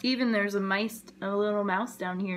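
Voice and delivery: ordinary delivery, nothing marked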